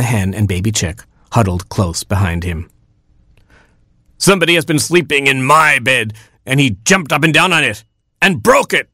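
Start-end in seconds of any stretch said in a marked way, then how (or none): 4.2-8.9 raised-pitch indignant rooster, lightly voiced; crying out. A narrator's reading. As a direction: exclaim it higher, mildly outraged. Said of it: "cried Papa Rooster".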